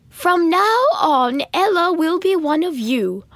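high, slow, loud pronouncement; stern decree. A narrator's reading. Stepmother demoting Ella before the servants, slow and loud. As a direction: declare it to the whole household.

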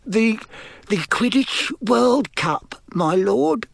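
high, hesitant, halting query; timid servant. A narrator's reading. Servant querying his lord's plan, timid. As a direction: falter on it in a high voice. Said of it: "said Wormtail".

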